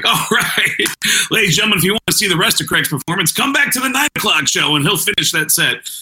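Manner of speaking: high-pitched emcee announcement; hurried, high MC patter over the crowd; club MC. Retold or imitated. MC covers for Craig's failed set, brisk announcer voice. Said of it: "The M C comes on stage".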